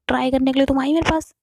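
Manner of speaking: indignant complaint. Acted. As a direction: rattle off the grievance.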